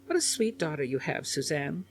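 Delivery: a hint of a kindly elderly woman; gentle, rising on Suzanne; lightly voiced old lady. A narrator's reading. Elderly sitter praising the daughter, lightly voiced.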